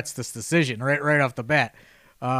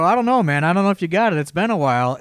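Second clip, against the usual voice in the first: higher, louder mock doubt, needling a friend; antagonizing; 5 semitones higher, 6 dB louder than usual. Teasing an old wrestler at the bar, higher, louder mock doubt. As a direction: rib him about losing it, a touch louder and higher. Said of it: "I just start kind of antagonizing him".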